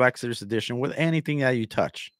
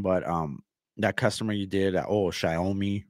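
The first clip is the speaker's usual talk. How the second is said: normal talking voice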